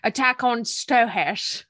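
put-on slow Scottish accent, slushy "sh" for s; Sean Connery. Teasing host with slow Scottish celebrity accent. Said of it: "That's my best Sean Connery accent".

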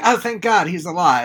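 high, loud gush of relief; hammed-up relieved exclamation; mock relief. Co-host reacts to Ward being alive, mock relieved outburst.

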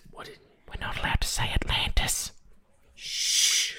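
hushed, secretive whisper. Acted. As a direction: whisper it as if it's forbidden, then shush. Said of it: "and don't whisper. It's weird".

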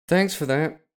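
high, loud barmaid voice. A narrator's reading. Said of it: "the barmaid said".

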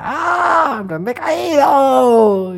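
high shrieking cry, stretched out; mock scream of fear. Retelling a thriller scene, the fallen heroine's mock shriek. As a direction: shriek it like a horror heroine falling. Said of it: "se cae y grita".

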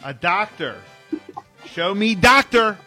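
usual speaking voice

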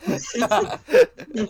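squealing through laughter; high laughing squeal. Real, not acted.

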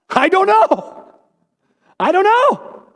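very high, slow, mock-helpless "I don't know"; squeaky comic "I don't know". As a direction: squeak it out like a baffled shrug. Comic refrain answering hard questions.

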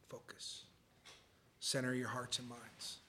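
whispered focus. Real, not acted. Calls the room to focus.

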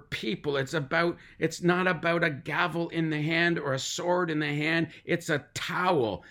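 lauter, drängender Predigtton; preaching. Acted. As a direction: steigere dich wie ein Prediger auf der Kanzel. Preacher builds to full force.

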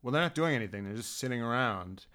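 low, soft, bored parent voice; parents unimpressed. Retold or imitated. Parents watching the race in the rain, bored low grumble. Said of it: "they're like".

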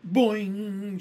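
loud, high playful boing; spring the sound out like a toy; cheeky cry of boing; gleeful cry. A narrator's reading. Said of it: "she cried".

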